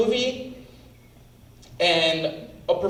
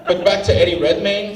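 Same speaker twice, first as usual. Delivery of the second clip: normal talking voice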